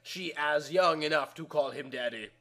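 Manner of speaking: plain reading voice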